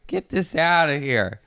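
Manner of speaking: loud, high, drawn-out complaint to mom; mock calling out. Child to mom about her dropped torpedo, exasperated call.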